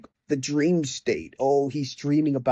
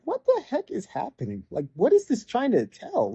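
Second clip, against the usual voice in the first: baffled disbelief; 8 semitones higher than usual. Retold or imitated. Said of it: "And I'm like".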